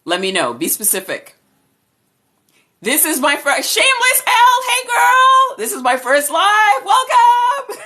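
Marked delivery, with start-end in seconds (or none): 2.8-7.8 very high, loud, joyful shouted welcome; thrilled shouting. Real, not acted. Greeting a friend on her first live, shrieking with joy. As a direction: let the excitement explode.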